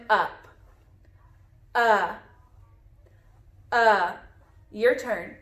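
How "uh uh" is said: a short, clear "Uh" for the letter U; short u sound. Shown as a demonstration. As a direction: model the short vowel "Uh" for the class. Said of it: "it has a short sound of uh".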